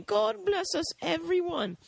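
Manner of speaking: high, put-on little boy voice; Tiny Tim. Little boy closing out the movie, hammed-up child voice. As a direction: mimic a small child in falsetto. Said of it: "little boy dressed as Tiny Tim".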